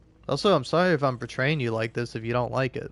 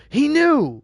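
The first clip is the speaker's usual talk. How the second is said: much higher and louder, unhinged cry; maniac shriek; 9 dB louder than usual. Acted. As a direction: let the panic burst out. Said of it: "maniac voice".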